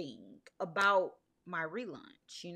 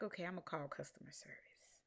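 resigned whisper; 9 dB quieter than usual. Retold or imitated. Resigned thought in carpool line.

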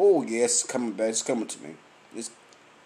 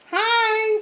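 calling out. Retold or imitated. Coworkers calling his name from across the street, high call-out. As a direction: sing out a greeting from far away.

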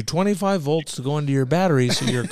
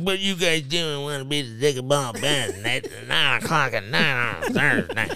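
mocking Haley; 5 dB quieter than usual. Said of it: "Don't come in here because you're all serious".

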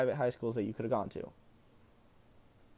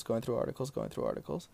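mumbling; 2 semitones lower, 7 dB quieter, faster than usual. Retold or imitated. Mimicking friend's reading mumble.

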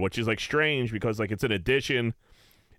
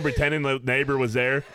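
ordinary talk

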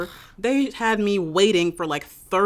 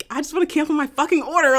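higher, louder retold yell; mock angry yell; 10 semitones higher, 5 dB louder, faster than usual. Retelling yelling at the store's phone, mock shout. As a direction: vent the fury at the phone. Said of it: "screaming at the thing".

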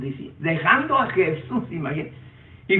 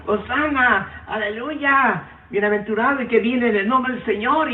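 disciples shouting hosanna; 8 semitones higher than usual. Acted. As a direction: lift it up like a crowd hailing the king. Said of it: "iban gritando".

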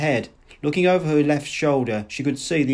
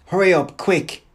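angry urging; 5 semitones higher, 4 dB louder than usual. A narrator's reading. Man threatening her to leave.

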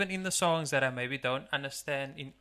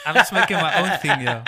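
laughing; 10 dB louder, faster than usual. Real, not acted. Joking about own career.

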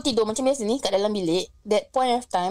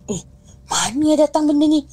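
louder, higher startled cry; woman alone at night hearing knocks, louder and startled; startled fright; 5 semitones higher, 7 dB louder than usual. Retold or imitated. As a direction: jump at a strange noise in the dark.

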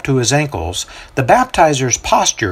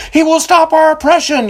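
much higher, loud yell; 5 dB louder than usual. A narrator's reading. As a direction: cry it out from the back. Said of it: "yells out another".

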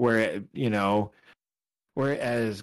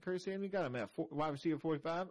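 lightly higher Southern accent, a bit quick; 6 semitones higher, 10 dB quieter, faster than usual. Real, not acted. Host reading his rankings, still in Southern accent. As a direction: keep the soft Southern accent going over the rankings. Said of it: "I'm still doing it".

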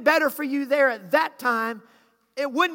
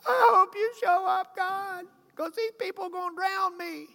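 hushed worried voice breaking into a higher mock cry; Moses panicking inside; 5 semitones higher, 5 dB quieter than usual. Moses quietly begging God not to be drowned. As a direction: pray under your breath like a panicking leader. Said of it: "Moses is going".

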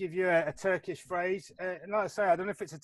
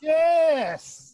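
a high, loud burst of delight; excited yes; 10 semitones higher, 11 dB louder than usual. Real, not acted. Host cheers the guest's right answer, thrilled and loud. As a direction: cheer as the guess lands.

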